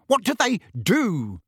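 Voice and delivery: high-pitched, irate question; furious. A narrator's reading. Uncle presses Harry about the creatures, furious. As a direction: demand it, pitch jumping up. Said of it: "asked Uncle Vernon furiously".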